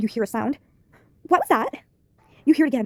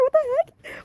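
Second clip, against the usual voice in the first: much higher, slow, drawn-out shock; new high voice for her; 14 semitones higher, much slower than usual. Shown as a demonstration. The girl caught in a towel, crying out much higher and slow. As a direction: stretch it out high like a startled girl. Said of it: "what should her voice be? It has to be really good".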